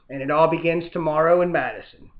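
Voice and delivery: ordinary talk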